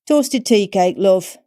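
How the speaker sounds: high, loud cheery tea-shop crone; an old café crone calling over the table. A narrator's reading. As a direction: sing it out like a nosy old waitress.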